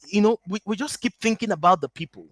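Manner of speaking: ordinary delivery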